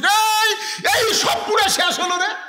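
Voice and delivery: very high, loud cry of alarm; acted alarm shout. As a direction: yell out that disaster has struck. Acted shout at burning food.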